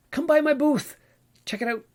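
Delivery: high, sing-out invitation; playful calling out. Pretend. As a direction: pitch it way up, inviting passers-by over. Inviting listeners to convention booth.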